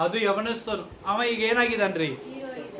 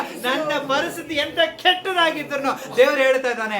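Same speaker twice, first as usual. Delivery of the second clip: normal talking voice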